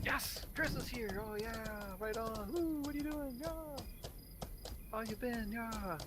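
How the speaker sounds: high, cheerful greeting crowd; genuinely glad to see him; happy welcome. Retold or imitated. Friends happily greeting Chris at a party, high and cheerful.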